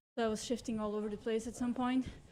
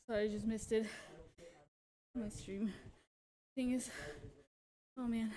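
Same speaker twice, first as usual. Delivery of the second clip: out of breath; 6 dB quieter than usual. Real, not acted. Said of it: "let me catch my breath here".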